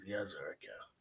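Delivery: whispered aside; whispering. Real, not acted. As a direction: whisper it to yourself.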